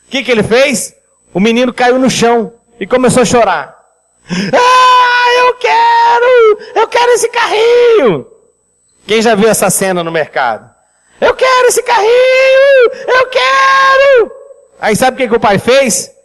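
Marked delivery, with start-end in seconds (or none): 4.3-8.3 agudo e gritado, criança chorona; criança fazendo birra. Acted. 11.2-14.3 agudo e gritado, criança chorona; criança fazendo birra. Acted.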